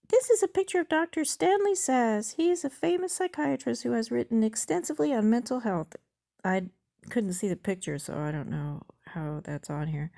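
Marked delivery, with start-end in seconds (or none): 0.1-6.0 high-pitched, louder bot character; chatbot voice. Acted. Chatbot presents the doctor's picture to user, high bot voice.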